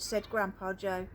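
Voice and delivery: ordinary delivery, nothing marked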